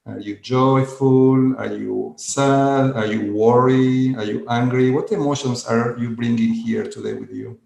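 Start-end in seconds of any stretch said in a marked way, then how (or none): none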